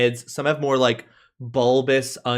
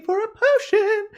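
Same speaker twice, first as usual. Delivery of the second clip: high little-guy voice. Acted.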